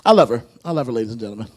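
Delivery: low, very soft sincere admission; a hushed, heartfelt declaration; tender confession. Acted. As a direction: confess love gently.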